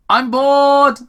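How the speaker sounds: high, loud bored whine; bored complaint. Acted. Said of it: "Tom complained".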